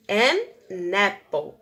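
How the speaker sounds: "An apple" drawn out, linking "an" into "apple"; teacher stressing the article an; slow model phrase. Shown as a demonstration. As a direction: stretch and link "An apple". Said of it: "Então, an apple".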